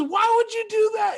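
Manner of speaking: high, loud tearful cry; imitated crying girl. Girl crying at her boyfriend after the slap, high and loud. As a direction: wail it like the hurt girlfriend.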